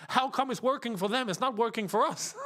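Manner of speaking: cracking up mid-sentence; words bursting through laughter; laughing. Real, not acted. Streamer laughs at the thought of a rival fan, laughing.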